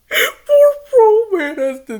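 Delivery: high, loud laugh through the words. Real, not acted. Reader cracks up over the lazy villain, laughing hard. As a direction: crack up while you say it.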